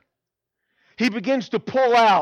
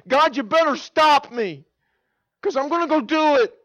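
impassioned, raised-voice ultimatum; urgent warning; 8 semitones higher, 5 dB louder than usual. Retold or imitated. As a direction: cry it out to God with resolve. Retold warning to God in prayer.

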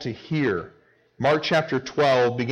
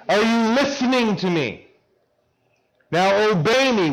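raised pitch, demanding an answer; mock exasperated parent; 8 semitones higher, 3 dB louder than usual. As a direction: imitate a parent scolding an ignoring child.

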